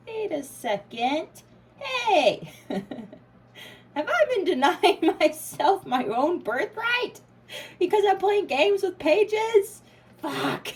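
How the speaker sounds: a stunned, giggling wake-up call; laughing realization. Retold or imitated. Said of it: "you're realizing".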